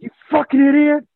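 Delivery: high harsh whispered yell; furious. Retold or imitated. Scolding the friend after the near crash, high harsh whispered yell. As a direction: spit it at him after punching him.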